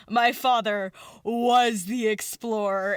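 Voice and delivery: slow, weighty announcement; dramatic reveal. Retold or imitated. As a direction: draw it out like a grand revelation. Ambition's big reveal, acted.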